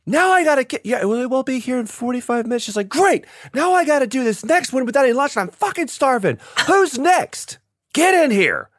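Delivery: high, put-on voice of a cranky boss; grumpy casting director. Casting director complaining about lunch, cranky. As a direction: grumble like a hungry, annoyed boss. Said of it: "she was like".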